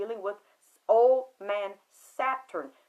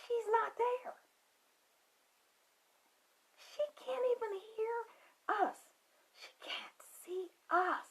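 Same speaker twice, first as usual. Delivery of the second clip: hushed whisper; 10 semitones higher, 8 dB quieter than usual. Retold or imitated. Eerie reveal, voice drops.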